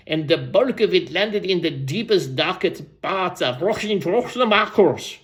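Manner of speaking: a put-on storyteller voice, relishing the tale; Random Ransom. Old storyteller tells the crowd of a cracked rainbow, character voice. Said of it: "I'll go back to Random's voice".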